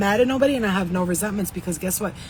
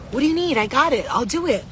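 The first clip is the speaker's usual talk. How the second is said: higher-pitched, lightly acted eager helper; lightly played people-pleaser, pitched up; 6 semitones higher, 3 dB louder than usual. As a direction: offer help in a quick-to-please, raised pitch. Acts out the eager little helper.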